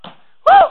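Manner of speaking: loud, high preacher's whoop; revival-service whoop; excited whoop. Real, not acted. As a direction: let out a sudden piercing "woo". Preacher whoops after climax.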